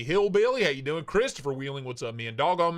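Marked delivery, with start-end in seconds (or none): none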